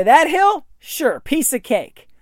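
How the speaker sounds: breezy confidence. Retold or imitated. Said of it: "You just say".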